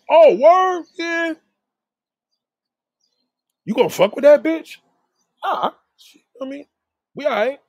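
high mock girl voice, then a shrugging reply; gossiping girls. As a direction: play the gossiping girlfriends, high and sassy.

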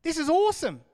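loud, high burst of excitement; excited Peter. Acted. Peter thrilled to be with Moses and Jesus, excited. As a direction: exclaim it, thrilled, at full voice. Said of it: "He's excited".

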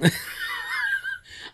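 high screaming laugh; shrieking laugh. Real, not acted. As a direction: cackle up into a shriek.

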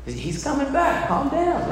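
sing-song it, like it's no big deal; mock chill reassurance. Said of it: "I walk into that moment and I say".

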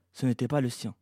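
ordinary delivery, nothing marked

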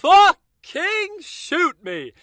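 high, slow anguished plea; drawn-out, exaggerated exasperation; exasperated outburst. Real, not acted. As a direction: cry out in mock despair.

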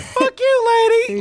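put-on high-pitched bratty kid shriek; bratty kid yelling. Friend quoting a rude kid character, high and squealing. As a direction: squeal it like a foul-mouthed little brat. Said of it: "he's a classic, like".

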